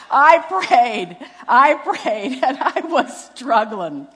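high, loud protest; hammed-up desperation; comic exasperated insistence. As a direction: insist loudly and high, milking it for laughs. Comic insistence on her struggle.

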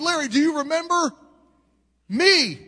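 tearful pleading. Retold or imitated. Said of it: "now I got tears in my eyes".

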